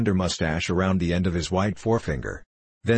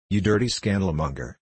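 plain reading voice

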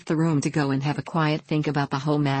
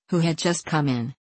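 usual speaking voice

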